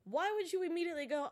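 ordinary delivery

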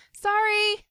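loud call over music; shouting over music. Acted. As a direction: shout to be heard.

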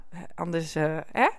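talking around a sip of coffee; while sipping coffee. Real, not acted. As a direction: mumble just before taking a sip. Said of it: "even een slokje koffie tussendoor".

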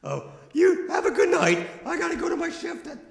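put-on high, eager kid voice; squeaky young burger worker; high-voiced nervous teen. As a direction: chatter like a jittery fast food kid. Holds Billy Bopper voice.

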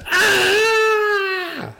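high, loud scream of fright, hammed up; terrified scream. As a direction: let out a piercing shriek as if you saw a ghost. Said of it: "she cried out at the top of her voice".

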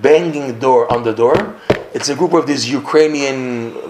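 normal talking voice

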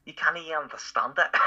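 high mock Scouse turning Scottish; slipping into Scottish. Shown as a demonstration. Mocking Liverpool talk, accent sliding into Scottish. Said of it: "now I've gone into Scottish".

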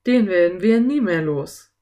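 loud exasperated complaint. A narrator's reading. Philipp stuck in the octopus's grip, loudly exasperated. Groaned despair at being trapped.

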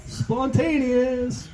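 high, very slow mocking drag of the word; sarcastic echo. Comic sneering at her idea of spontaneity, slow and high. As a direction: stretch it out with scorn.

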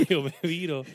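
ordinary delivery

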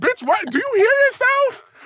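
high-pitched, mock-incredulous scolding; exasperated disbelief. Acted exasperated reply to drunk friend.

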